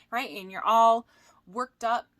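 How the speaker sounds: ordinary talk, nothing marked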